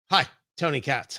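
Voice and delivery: ordinary talk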